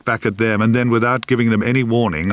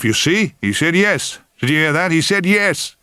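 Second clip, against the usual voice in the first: triumphant cry; 7 semitones higher than usual. Acted. Grandfather crowing to the family that baby said yes, triumphant cry. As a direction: crow it like a proud win. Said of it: "would cry out in triumph".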